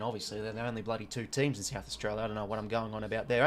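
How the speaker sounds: usual speaking voice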